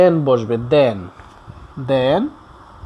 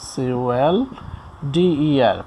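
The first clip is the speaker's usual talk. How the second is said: letter by letter, very slow and softer; slow spelling; 3 semitones lower, 5 dB quieter, much slower than usual. Shown as a demonstration. Teacher spelling out colder for learners, slow spelling. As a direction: spell it out quietly, as if writing along.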